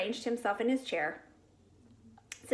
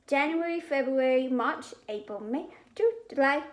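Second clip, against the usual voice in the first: reciting; 3 semitones higher than usual. A narrator's reading. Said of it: "Fudge recited".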